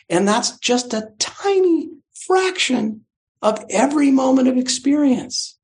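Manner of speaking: high, slow, drawn-out amazement; marvelling amazement. Real, not acted. Teacher marvels to students that sounds carry meaning, high amazement. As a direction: stretch it out high, truly astonished. Marvels at how language works.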